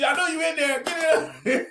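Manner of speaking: high, loud shout at the door; shouting through door. Retold or imitated. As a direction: yell it at the locked door.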